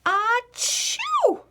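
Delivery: loud high achoo sneeze. A narrator's reading. Louise sneezing over her oatmeal, big loud sneeze. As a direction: burst out a huge sneeze.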